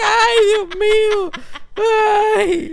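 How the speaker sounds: hammed-up fright with a laugh in it; mock terrified. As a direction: whimper like the movie has you cornered. High scene in the movie.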